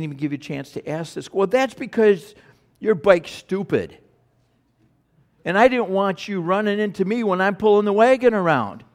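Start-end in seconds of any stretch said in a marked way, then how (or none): 1.3-8.8 put-on higher, louder bratty girl; a sister brushing off her brother's complaint; snippy child. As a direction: sass back like a little girl. Girl voiced answering back.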